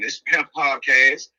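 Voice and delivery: ordinary talk, nothing marked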